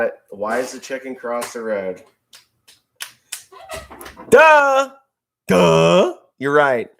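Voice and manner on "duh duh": mock duh. Mocking himself for slowness.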